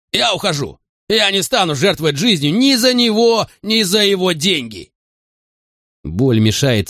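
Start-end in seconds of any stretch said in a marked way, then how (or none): 0.1-4.9 very high, agitated outburst; snappish refusal. A narrator's reading. Wayne won't give his life for money, snappish refusal. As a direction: refuse at a sharply raised pitch. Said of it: "огрызнулся Уэйн".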